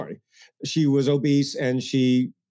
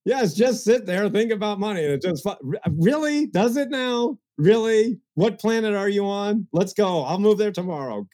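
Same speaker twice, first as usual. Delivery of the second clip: higher, mocking disbelief; worked-up sarcasm; 6 semitones higher than usual. Real, not acted. Scoffing at wishful thinkers to the host, sarcastic. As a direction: ridicule the idea with raised pitch. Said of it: "I get a little worked up".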